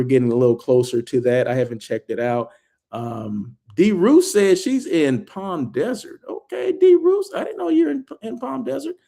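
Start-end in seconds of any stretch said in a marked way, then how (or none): 6.3-9.0 high-pitched, surprised discovery; pleasantly surprised. Real, not acted. Host learns where a viewer lives, surprised. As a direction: react with surprise, voice leaping up.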